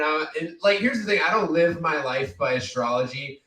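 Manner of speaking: normal talking voice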